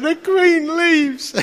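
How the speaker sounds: drawn out high and slow; awestruck wonder. Retold or imitated. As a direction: marvel at it slowly. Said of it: "I'm going like".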